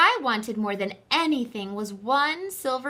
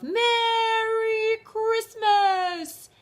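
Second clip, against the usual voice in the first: high, slow, drawn-out call; shouted; 12 semitones higher, 6 dB louder, much slower than usual. A narrator's reading. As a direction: call it across to the moving train. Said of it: "he shouted".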